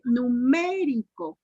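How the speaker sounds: "Numérico" drawn out, MÉ stressed; slow syllable-by-syllable. Shown as a demonstration. Teacher asking where the accent goes, "Numérico" slowly split with MÉ stressed. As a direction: stretch "Numérico" slowly and lean on MÉ. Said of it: "la pronuncien por sílabas despacito".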